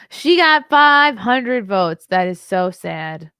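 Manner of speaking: hammed-up fake sympathy; mock pity. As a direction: drag it out in fake sorrow. Mock pity over the result.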